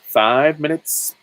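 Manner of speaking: five minutes stretched slow and loud. Shown as a demonstration. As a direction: stretch it out and raise it. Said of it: "drawn-out repeat".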